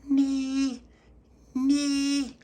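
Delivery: put-on very high loud baby squeal; baby shouting. Baby Lucia shouting through the letterbox, very high squeal. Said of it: "she shouted through the letterbox".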